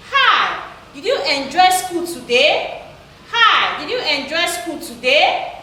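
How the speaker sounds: "today" lifted at the end as a yes-or-no question; rising question tone. Shown as a demonstration. Teacher models a yes-or-no question for pupils, rising tone. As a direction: read the question with the voice going up on "today". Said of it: "your voice goes up".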